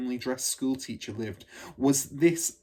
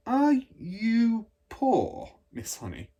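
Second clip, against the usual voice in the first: put-on slow, higher, careful little girl; hesitant child question; 11 semitones higher, 5 dB louder, much slower than usual. Matilda carefully asks her teacher a delicate question, slow and hesitant. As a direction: ask it gently, unsure if you should.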